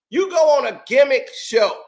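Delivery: ordinary talk, nothing marked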